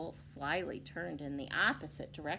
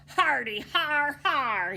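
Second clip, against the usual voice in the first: slow, loud, higher hammed-up laugh; wild villain laugh; 8 semitones higher, 12 dB louder, slower than usual. Wicked wolf racing off, laughing wildly. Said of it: "he laughed wildly".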